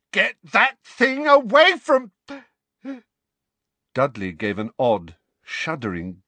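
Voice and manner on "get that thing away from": loud, very high, cut-off shout; frantic high-pitched order; panicked yell. A narrator's reading. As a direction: shriek it in alarm.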